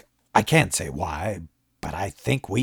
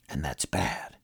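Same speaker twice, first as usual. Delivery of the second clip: softer, whispered warning; grim whisper; 4 semitones lower, 5 dB quieter than usual. A narrator's reading. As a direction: let the dread drop low.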